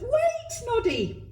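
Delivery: high wailing call after him. A narrator's reading. Martha wails after the fleeing Noddy. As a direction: wail his name as she chases. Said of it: "Martha wailed".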